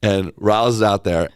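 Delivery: ordinary delivery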